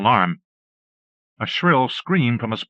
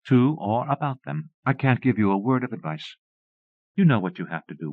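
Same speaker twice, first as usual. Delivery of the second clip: ordinary delivery, nothing marked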